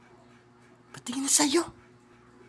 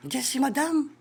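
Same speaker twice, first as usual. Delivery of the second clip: ordinary talk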